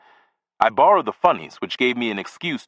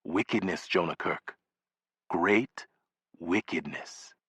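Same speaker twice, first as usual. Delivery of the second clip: much softer, low and grave "Wickedness"; ominous hush; 4 semitones lower, 10 dB quieter, slower than usual. Acted. Neighbor warning the boy of evil in the building, hushed and grave. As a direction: let "Wickedness" sink down quietly like a dire warning.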